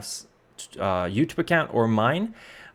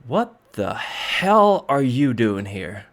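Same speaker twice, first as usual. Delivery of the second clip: slow, emphatic demand, leaning hard on hell; sheriff's startled challenge; much slower than usual. Acted. Sheriff finding strangers asleep in the shed, emphatic challenge. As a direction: confront intruders, drawing the words out. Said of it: "you hear a woman's voice going".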